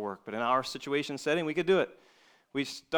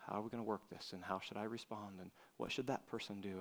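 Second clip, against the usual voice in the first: much softer, lower voice; inward pondering; 5 semitones lower, 13 dB quieter than usual. Acted. Preacher voicing thoughts on relationship issues, quiet inward musing. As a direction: think it through under your breath.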